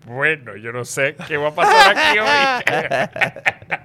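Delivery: laughing shout. Retold or imitated. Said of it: "y ella dijo".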